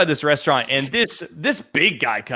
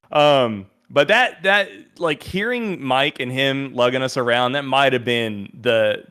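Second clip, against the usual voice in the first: ordinary talk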